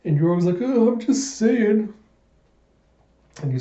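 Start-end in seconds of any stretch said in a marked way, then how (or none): 0.6-1.9 higher and slow, backing off; defensive old-timer. Acted. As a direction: drag it out innocently, like an old man caught out. Old-timer shrugs off scolding.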